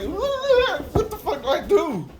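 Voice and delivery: high, loud, drawn-out mock panic; flailing panic. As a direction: imitate someone flailing helplessly. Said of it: "starts fucking flailing".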